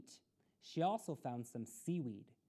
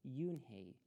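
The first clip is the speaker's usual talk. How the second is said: ordinary delivery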